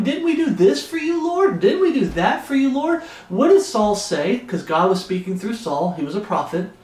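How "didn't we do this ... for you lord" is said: high, whiny mock plea; mocking false believers. Preacher mocking self-righteous believers to the congregation, high whiny plea. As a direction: squeak out the excuses like a smug churchgoer. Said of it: "people that are saying".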